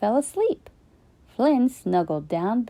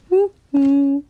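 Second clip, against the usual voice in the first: loud high walrus hoo-hoo; hammed-up creature goodnight; walrus hoot; 6 semitones higher, 6 dB louder than usual.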